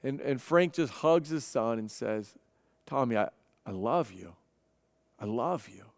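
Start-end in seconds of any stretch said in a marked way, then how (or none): 2.8-5.8 hushed, much softer loving words to his son; barely above a breath, holding his son; tender father. Retold or imitated. Father hugs addicted son.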